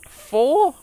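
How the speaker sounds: high, playful squeaky four; silly high question. Pretend. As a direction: play with the word four. Repeating number in mock voices.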